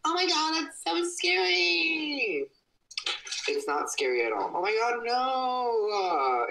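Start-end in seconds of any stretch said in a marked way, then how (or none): 0.1-2.5 put-on high, slow, frightened child; scared kid voice. 4.6-5.9 high, slow, horrified cry; mock horror.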